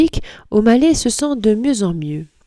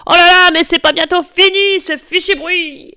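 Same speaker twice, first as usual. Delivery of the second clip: grumpy neighbour; 10 semitones higher than usual. A narrator's reading. Neighbour complains of noise.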